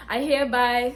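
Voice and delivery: giggling through the words; laughing. Real, not acted. Reading the form aloud, laughing. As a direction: laugh it out while quoting.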